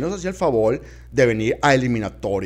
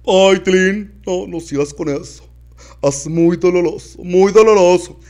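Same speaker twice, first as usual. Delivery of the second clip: pained aunt; 6 semitones higher, 6 dB louder than usual. Acted.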